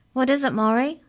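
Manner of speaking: high, loud booming question. A narrator's reading. Giant cook asks her partner, high and booming. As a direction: boom it out in a high voice. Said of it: "Her voice was higher pitched, but equally booming".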